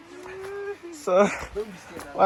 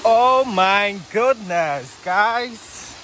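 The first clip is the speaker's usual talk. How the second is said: shaking with effort; 5 semitones higher than usual. Real, not acted. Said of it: "my legs are literally shaking".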